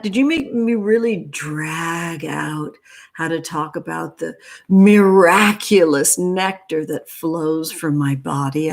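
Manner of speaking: ordinary talk, nothing marked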